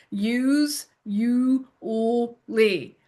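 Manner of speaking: "Use, you, lee" as even, separate syllables; sounded out. Shown as a demonstration. Tutor writing it the way it sounds, syllable by syllable. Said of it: "the way you feel it sounds".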